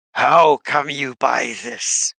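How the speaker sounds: a lightly voiced gnoll asking slowly in halting common; slow, halting creature speech, only lightly put on; gnoll in common tongue. Gnoll shaman questions Bazbeer about the book, in lightly voiced halting common. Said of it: "In common".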